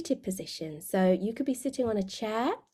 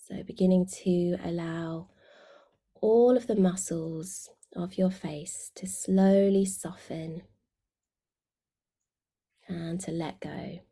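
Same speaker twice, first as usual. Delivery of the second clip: guided meditation. Real, not acted. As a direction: guide them gently inward, letting phrase endings sink away.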